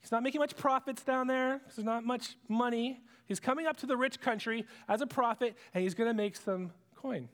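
ordinary delivery

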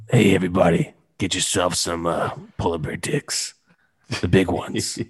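creepy dude. Pretend. Host signs off as the creep, mildly creepy. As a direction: drop low for a sleazy sales pitch. Creepy bit revived at sign-off.

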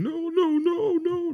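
very high, loud wailed whimper; imitated whimpering. Son showing how dad cried out at night, high whimpering imitation. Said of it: "my dad was whimpering".

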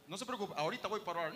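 quick, lightly voiced eager helper; fast, light imitation of the eager brother. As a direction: rush the offer cheerfully. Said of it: "le dice al hermano".

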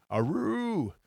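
long howling Aroo; wolf voice. Acted. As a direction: howl it like a wolf. Howl sound in forest.

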